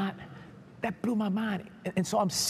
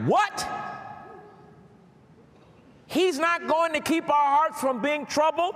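loud-edged, high-pitched disbelief; incredulous; 12 semitones higher, 3 dB louder than usual. Retold or imitated. Preacher replaying their own shock at the verse, incredulous. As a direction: blurt a high "What?", then protest in disbelief. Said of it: "I'm thinking like".

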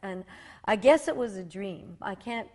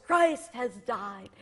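slow booming declaration; booming thunder voice; slower than usual. Retold or imitated. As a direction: let it roll like thunder. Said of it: "thunder, booming voice".

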